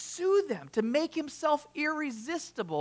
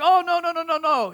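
high, loud mock African accent; bad African accent; 7 semitones higher, 8 dB louder than usual. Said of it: "that's my bad, uh, African accent".

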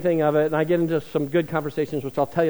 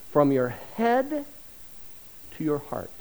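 ordinary talk

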